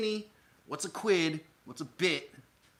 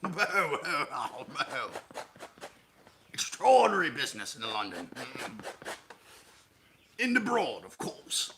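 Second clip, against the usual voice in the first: put-on chortling British old-heckler caricature; Muppet hecklers; 2 semitones higher than usual. Playing the businessman as a Muppet heckler, chortling British voice. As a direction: huff like a pompous Muppet heckler. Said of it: "like the hecklers".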